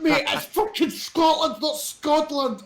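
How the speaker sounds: high, slow mock of Scotland; mock American Scotland. Friends mocking the American Scotland, high and slow. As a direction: ham up Scotland in a put-on voice. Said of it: "It said Scotland with a D".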